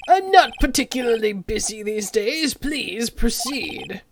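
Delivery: slow, lightly voiced old lawyer; a hint of a pompous old man; slow, self-important old attorney. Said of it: "what should his voice be?".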